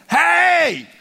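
a huge, high shout to the crowd; cried out. Retold or imitated. Jesus cries out to the feast crowd, huge shout. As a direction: call out over the whole festival. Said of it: "Jesus stood up and cried out".

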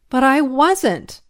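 loud, high, slow protest; angry denial. Acted. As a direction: deny it angrily, leaning hard on the words. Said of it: "I denied angrily".

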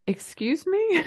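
high, incredulous "Excuse me?"; as if you can't believe what you heard; mock offended, pitch jumps; indignant disbelief. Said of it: "I was like".

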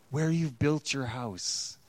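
ordinary delivery

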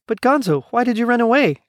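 high, urgent questioning. A narrator's reading. Keisha asking Gonzo why he fled, urgent. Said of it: "asked Keisha urgently".

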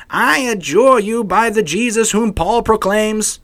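high, loud, slow proclamation; exorcist's command. Acted. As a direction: invoke it grandly, voice lifted high. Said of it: "undertook to invoke the name".

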